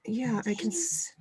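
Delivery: hushed half-word aside; whisper. Real, not acted. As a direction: drop to a whisper mid-thought. Whispering while hunting mute button.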